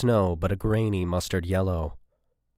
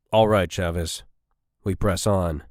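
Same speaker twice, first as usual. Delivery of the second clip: firm order; 3 dB louder than usual. A narrator's reading. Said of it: "Marks ordered".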